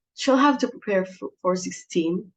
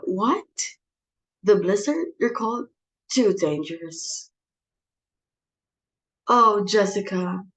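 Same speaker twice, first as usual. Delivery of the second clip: usual speaking voice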